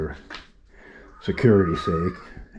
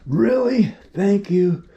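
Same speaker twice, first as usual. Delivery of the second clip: grateful excitement; 11 semitones higher, much slower than usual. Retold or imitated. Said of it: "and she says".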